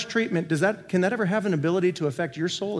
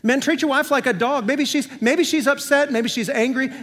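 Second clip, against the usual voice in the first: punchy, higher and louder mock headline; mock soundbite; 6 semitones higher, 3 dB louder than usual. Shown as a demonstration. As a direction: toss it out like a quotable soundbite. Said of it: "Somebody put that on Facebook".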